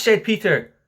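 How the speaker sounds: plain reading voice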